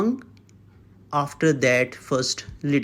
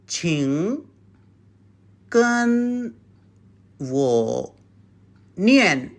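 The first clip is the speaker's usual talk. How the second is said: syllable by syllable; much slower than usual. Shown as a demonstration. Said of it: "Just these four sounds".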